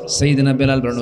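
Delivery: ordinary talk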